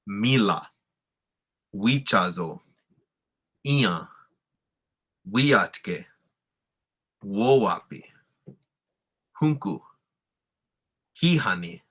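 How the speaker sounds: each word from mila to hihani punched on its first syllable; first-syllable stress. Shown as a demonstration. Teacher reading a word list, stressing every first syllable. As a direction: lead hard on MI in mila, HI in hihani. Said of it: "stress mark is on the first syllable".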